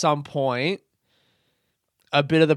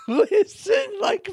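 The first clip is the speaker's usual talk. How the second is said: laughing shout; slower than usual. Real, not acted. Laughing shout mid pitch.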